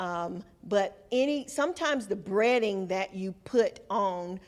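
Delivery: ordinary talk, nothing marked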